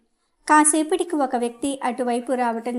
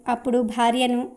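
ordinary delivery

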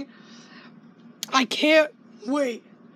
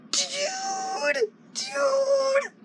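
very high, thin, soft squeal; drawn-out breathless fan squeal; overexcited squeal; 10 semitones higher, 6 dB quieter than usual. Real, not acted. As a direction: squeak it out, overwhelmed with hype.

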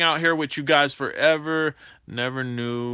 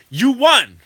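louder, higher mock announcement; announcing a win; 8 semitones higher, 8 dB louder than usual. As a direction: play the clerk telling him he won. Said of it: "They're like".

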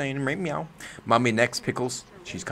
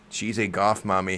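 ordinary delivery

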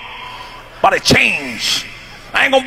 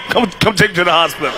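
quick, low mock urgency; rapid-fire retold plea; hurried plea; 4 semitones lower, faster than usual. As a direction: act out hustling someone along. Acts himself demanding the hospital.

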